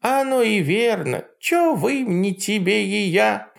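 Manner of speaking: high mocking old witch; Baba Yaga voice. A narrator's reading. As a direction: sneer like a jealous hag. Yaga continues.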